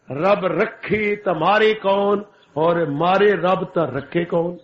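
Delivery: ordinary talk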